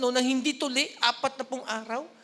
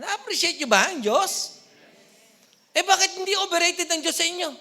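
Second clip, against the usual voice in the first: louder, higher preacher challenge; challenging call; 7 semitones higher, 8 dB louder than usual. Real, not acted. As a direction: press the congregation hard.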